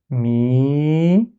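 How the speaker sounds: mi held long with a gradual rise; prolonged vowel. Shown as a demonstration. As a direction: stretch out the i of "mi" as in a reading drill. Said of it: "il sert à prolonger".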